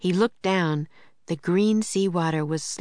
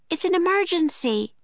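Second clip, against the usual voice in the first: urgent shout; 12 semitones higher than usual. Acted. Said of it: "Annie shouted".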